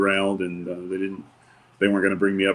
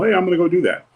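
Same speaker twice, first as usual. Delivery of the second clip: mock naive eagerness; 9 semitones higher, 5 dB louder, faster than usual. Mocking a naive TV fan.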